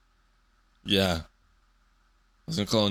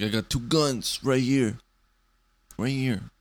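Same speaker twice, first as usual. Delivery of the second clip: mock show-off, slow and higher; flexing gym bro; 4 semitones higher, slower than usual. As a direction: show off the muscles. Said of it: "and they just flex".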